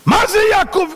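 high, loud scolding yell; shouted self-rebuke. Acted. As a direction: yell at yourself as the strict rabbi. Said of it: "פתאום הוא שומע צעקות". (צעקת תוכחה רמה)

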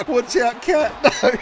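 ordinary delivery

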